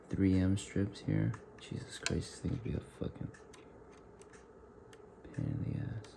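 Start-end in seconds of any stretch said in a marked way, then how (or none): 1.6-6.1 hushed, half-whispered grumble; quiet tired late night stream. Real, not acted. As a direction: keep it low so nobody wakes. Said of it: "I'm sorry if I'm talking low right now".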